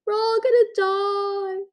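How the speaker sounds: high, loud and slow panicked wail; panicked shriek. A narrator's reading. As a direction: wail in terror. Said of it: "she shrieked".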